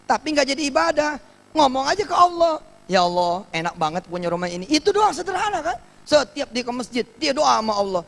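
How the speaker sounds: high, loud fervent preaching; rising to an impassioned sermon climax; preaching at full force. Acted. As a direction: drive the point home to the congregation. Fiery preaching peak.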